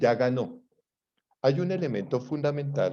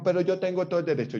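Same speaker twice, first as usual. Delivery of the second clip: higher, fast protest; a man insisting, pitched up; indignant man; 7 semitones higher, faster than usual. Retold or imitated. Imita al señor indignado. (el señor protestando, agudo y rápido)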